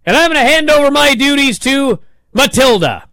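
put-on high, loud raspy old manager blurting out the news; high and loud wrestling promo voice; Captain Lou Albano impression. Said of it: "Captain Lou goes".

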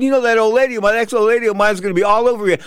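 high, loud, agitated pleading; retold frantic persuasion; urgent warning. Urges fighter with warning.

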